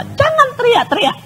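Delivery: high-pitched mock scolding; re-enacted angry yell. Mother scolding screaming three-year-old, imitated angry yell. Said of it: "saya ikut marah. Saya teriak gini".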